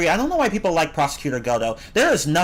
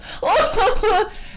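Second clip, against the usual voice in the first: squeaky high laugh breaking out of the diva voice; high-pitched cackle in character; high giggle. Retold or imitated. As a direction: giggle at your own impression. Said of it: "the mean girl's like".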